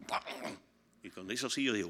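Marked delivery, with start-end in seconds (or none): 0.1-0.6 soft, voiceless imitated order; sin gritar. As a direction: mouth the order under your breath. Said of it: "Yo era así, yo no gritaba". (orden susurrada, sin gritar)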